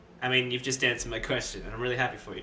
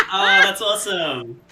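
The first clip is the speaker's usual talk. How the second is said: bursting laugh, much higher and louder; real laughter; 5 semitones higher, 12 dB louder than usual. Real, not acted. Player laughing hard at the goddess answer. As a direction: laugh out loud then gush.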